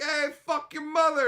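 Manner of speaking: hammed-up friendly insult; mock chat heckle. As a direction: bark it as a cheeky greeting. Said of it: "they're like".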